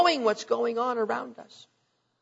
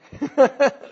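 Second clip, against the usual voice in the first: burst of laughter; 4 semitones higher, 6 dB louder than usual. Real, not acted. Laughing at kids hitting wall.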